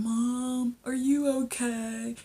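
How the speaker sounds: slow, high mock son voice; slithering Tariq. Son slithers in to mom, drawn-out mock concern. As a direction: stretch it out as a creeping son. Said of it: "So Tariq comes slithering".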